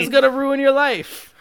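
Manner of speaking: high, loud hammed-up whine; mock father's excuse. Cohost mocks the villain father's excuse, hammed-up. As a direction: sneer the excuse back in a put-on voice.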